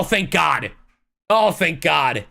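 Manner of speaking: ordinary delivery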